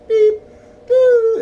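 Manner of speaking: high, loud electronic beeps; phone transfer tones. Retold or imitated. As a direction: imitate the beeps of a call transfer. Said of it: "she transferred me".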